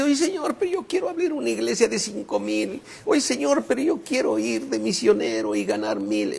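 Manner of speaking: high-pitched mock petitioner; eager pleading. Preacher mimicking a believer asking God for big ministries, high eager pleading.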